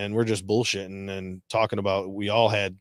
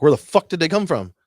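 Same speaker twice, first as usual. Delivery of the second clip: louder, higher, frustrated where the fuck; exasperated outburst; 7 semitones higher, 8 dB louder than usual. Retold or imitated. Teller reliving ducks surprising him on the hunt, loud and exasperated. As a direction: blurt it out, baffled and annoyed. Hunting, ducks appear from nowhere.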